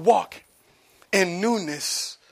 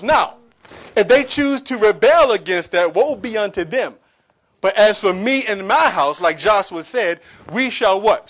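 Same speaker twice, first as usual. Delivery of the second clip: rising, louder sermon build; preaching; 5 semitones higher, 10 dB louder than usual. Acted. Preacher building to full force.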